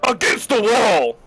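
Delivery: loud guard command; commanding order. Acted. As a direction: order her against the wall.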